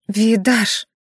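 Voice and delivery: mildly trembling call. A narrator's reading. Rena calls to her shaken husband, mildly trembling voice. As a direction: call the name with a touch of fear. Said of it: "дрожащим голосом позвала Рена".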